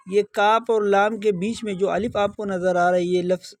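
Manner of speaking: ordinary talk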